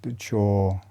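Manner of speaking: soothing body-awareness cues; guided meditation. Acted. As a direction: talk them through each breath, unhurried. Leads a chest breathing meditation.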